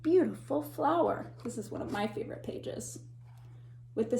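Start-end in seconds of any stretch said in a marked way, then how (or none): 1.4-3.0 soft, fast confiding whisper; quick whispered aside. Real, not acted. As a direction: share a little secret quickly. Personal aside to children.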